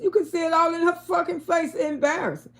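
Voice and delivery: ordinary talk, nothing marked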